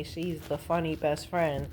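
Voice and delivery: ordinary talk, nothing marked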